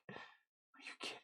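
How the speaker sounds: breathless whisper of disbelief; barely voiced, stunned quiet. Real, not acted. Stunned by game ending.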